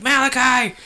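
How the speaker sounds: a high mock call of the name; imitating mom calling. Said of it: "his mom runs like".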